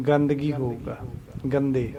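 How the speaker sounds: usual speaking voice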